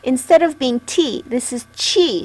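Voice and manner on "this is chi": chi said with a ch, not t; chi sound. Shown as a demonstration. Teacher showing that chi starts with ch. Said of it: "so it's a C H sound".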